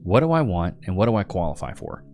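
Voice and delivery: normal talking voice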